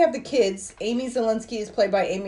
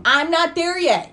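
raised, high, drawn-out objection; louder, higher and slower, cutting someone off; yelling; 8 semitones higher, 5 dB louder, slower than usual. Real, not acted. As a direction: protest loudly at being jumped ahead. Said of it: "you don't have to yell at me".

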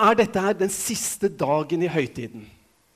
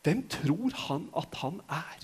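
hushed, softer, slow musing; quiet; 4 semitones lower, 9 dB quieter than usual. Retold or imitated. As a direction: drop the voice to a private wonder. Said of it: "quiet repeat of the crowd's question".

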